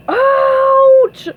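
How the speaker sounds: loud, high yelp of pain; long, loud cartoon ow; Patrick's pained cry. A narrator's reading. Patrick is stung by a jellyfish, high yelp. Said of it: "said Patrick".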